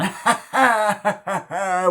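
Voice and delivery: high, loud cackling laugh; imitated character laugh. Fan showing how the actor laughs, loud imitation. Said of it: "him laughing".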